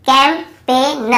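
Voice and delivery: Campaign broken up as "kam... pe... na"; slow model reading. Shown as a demonstration. Teacher sounds it out for learners, campaign slowly in pieces. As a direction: split campaign slowly into parts, no g. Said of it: "यहाँ पर भी जी silent है".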